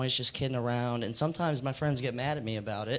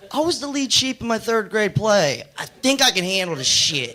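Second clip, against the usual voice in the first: louder, higher mock-offended protest; puffed-up offence, voice raised; indignant comeback; 6 semitones higher, 6 dB louder than usual. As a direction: defend yourself with hammed-up indignation. Indignant comeback to his date.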